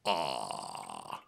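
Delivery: open-mouthed ah. Shown as a demonstration. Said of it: "show George how to say".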